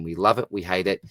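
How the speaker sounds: usual speaking voice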